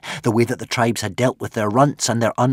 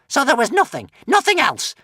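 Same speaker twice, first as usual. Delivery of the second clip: tense witch; 7 dB louder than usual. A narrator's reading. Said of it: "asked the witch".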